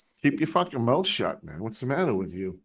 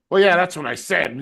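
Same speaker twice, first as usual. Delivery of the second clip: louder mock-grumpy comeback; grumpy retort; 3 semitones higher, 5 dB louder than usual. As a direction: imitate a grumpy customer snapping back. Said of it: "all fucking grumpy and shit".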